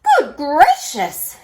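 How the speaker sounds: high, loud and slow; shocked. A narrator's reading. Mum astonished at the pelican on the fridge. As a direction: gasp it out in astonishment. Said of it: "said Mum".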